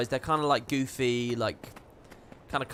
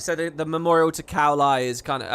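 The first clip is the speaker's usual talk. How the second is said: ordinary delivery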